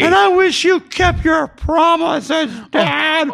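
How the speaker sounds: put-on slow, high, loud child's wail; Jamie. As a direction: wail each word at Dad like a wronged boy. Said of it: "I love my Jamie impression".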